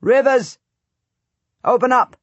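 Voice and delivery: high, loud call at the gate; Richard shouting. A narrator's reading. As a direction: bellow for the gate to open. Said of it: "Richard shout".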